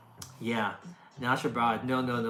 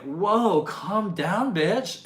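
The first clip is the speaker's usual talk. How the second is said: mock exasperation; 6 semitones higher, 6 dB louder, much slower than usual. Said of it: "it's like".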